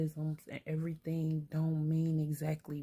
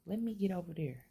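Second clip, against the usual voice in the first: curt command; 3 semitones higher than usual. Retold or imitated. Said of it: "You might as well say".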